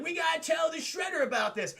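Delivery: high, shouted goon voice; mock henchman shout. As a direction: yell it like a panicked henchman. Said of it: "and they're like".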